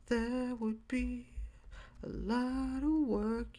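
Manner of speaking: high, stretched-out and slow; slow high drawl. Shown as a demonstration. Podcaster musing to listeners, drawn-out high voice. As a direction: drag each word out up high. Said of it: "keeps playing with the phrase".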